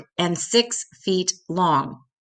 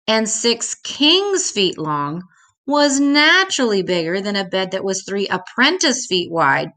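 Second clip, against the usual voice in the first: ordinary delivery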